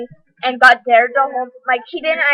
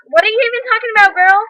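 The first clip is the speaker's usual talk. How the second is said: high, fast indignant comeback; quick, high-pitched challenge; indignant retort; 8 semitones higher, faster than usual. Retold or imitated. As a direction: fire back in mock disbelief. Retold confrontation with ex-best friend.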